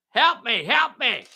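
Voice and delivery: high, loud cry for help; acted high-pitched plea; calling for help. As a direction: pop up and yell for rescue. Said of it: "Danny comes up out of the cabbages".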